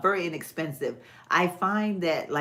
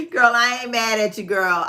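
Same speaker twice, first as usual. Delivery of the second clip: loud; 6 semitones higher, 7 dB louder than usual. Real, not acted. Laughing reply to viewer.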